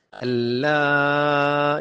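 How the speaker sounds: اللہ slowly, final vowel held long; lengthened madd. Shown as a demonstration. Teacher models madd for learners, long held vowel in اللہ. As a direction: stretch the end of اللہ on one steady tone. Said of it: "یہ بھی لمبا کرے".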